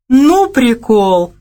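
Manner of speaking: slow, loud, high delighted exclamation; drawn-out amazed cry; exclaiming. A narrator's reading. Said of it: "воскликнула она".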